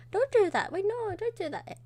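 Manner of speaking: high-pitched mock-panicked plea; urgent, hammed-up protest; alarmed protest. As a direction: cry out loud and high to stop them. Acted protest at shaving head.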